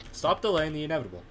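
ordinary talk, nothing marked

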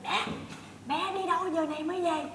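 small, subdued voice; keeping it down. Acted. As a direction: ask it meekly under your breath. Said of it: "Nói nhỏ nhỏ nha".

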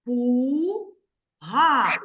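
slow, each syllable split apart. Shown as a demonstration. Teacher to pupils, slowly splitting the word into syllables. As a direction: spell out the syllables slowly for the kids. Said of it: "Промовте так само, як я".

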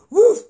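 high loud woof; mock dog bark. Dog barks in the retelling.

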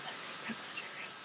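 hushed, conspiratorial aside; playful whisper. Pretend. Teasing her partner about the secret laundry room in a whisper.

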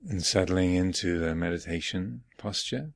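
unhurried phrases with long silences between; guided meditation. Real, not acted. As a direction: guide them gently inward, leaving space after each phrase. Leads guided morning meditation.

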